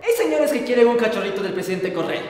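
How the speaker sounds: usual speaking voice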